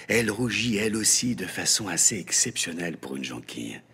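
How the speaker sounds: ordinary delivery, nothing marked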